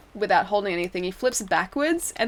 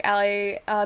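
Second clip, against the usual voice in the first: ordinary talk, nothing marked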